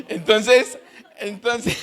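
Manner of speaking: real laugh breaking into the words. Real, not acted. Preacher trying to get back to the sermon, laughing. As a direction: try to resume through chuckles.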